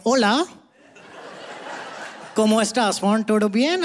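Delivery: put-on light Indian accent on cheerful Spanish greetings. Schoolboy greeting a classmate in Spanish, Indian accent. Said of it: "speaking Spanish in an Indian accent".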